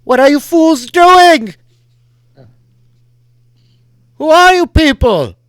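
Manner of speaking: high, huge booming shout from the rider; echoing rider's booming challenge. Acted. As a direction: thunder it out over the ship.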